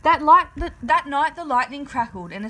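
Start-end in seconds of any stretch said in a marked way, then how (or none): none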